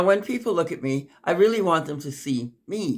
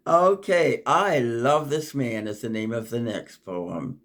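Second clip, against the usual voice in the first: ordinary talk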